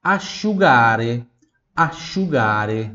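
asciugare said slowly, a-shu-GA-re; slow Italian teacher model. Shown as a demonstration. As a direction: stretch asciugare for repeating. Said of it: "Repita em voz alta".

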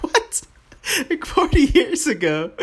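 "What?" shooting way up in pitch; stunned, squeaky disbelief; laughing shock at forty years; disbelieving surprise. Retold or imitated. Shocked at forty-year-old glasses.

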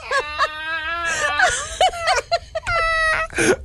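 high, breathless laughing fit; helpless laughter. Real, not acted. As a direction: dissolve into laughter. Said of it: "That makes me laugh so hard".

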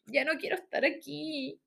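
voz aguda de niña harta, imitada; queja lloriqueada. Retold or imitated. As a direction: quejarse exagerando, cada vez más agudo. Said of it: "una empezó así como". (lloriqueo agudo de compañera hastiada)

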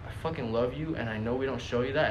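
normal talking voice

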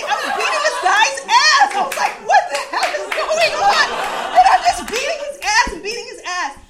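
high, loud, laughing through the story; breathless giggling brag; laughing excitedly. Real, not acted. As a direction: cackle while telling the fight. Laughing retelling of the fight.

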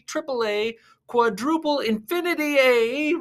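high, loud, hyped arena announcer; announcer intro. Acted. Clown commentator welcoming thumb wrestling fans, hyped announcer. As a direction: pump up the crowd like a ring announcer.

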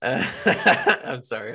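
slow apology through cackling laughter; laughing. Real, not acted. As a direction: still cackling while talking. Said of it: "I'm just cackling still".

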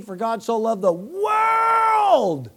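loud, high, slow shouted scripture; shouted verse, drawn out; yelled out. Retold or imitated. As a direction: bellow it out the car window. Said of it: "I even yelled out John three sixteen".

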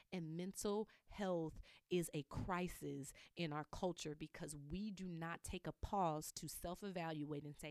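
ordinary delivery

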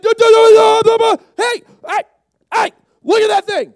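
high, loud babbling alarm; panicked stammering. Acted. Disciple pointing out the ghost, panicked. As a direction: yell to get everyone's attention.

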